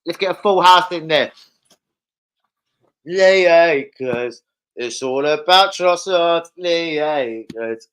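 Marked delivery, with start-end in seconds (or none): 3.1-4.4 drawn-out name. Real, not acted. 6.6-7.9 drawn-out name. Real, not acted.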